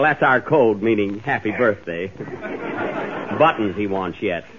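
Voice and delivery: ordinary talk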